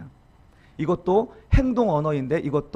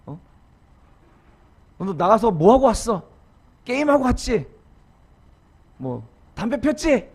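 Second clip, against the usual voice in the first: 억지; 3 semitones higher than usual. Retold or imitated. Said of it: "그런 억지 부리고".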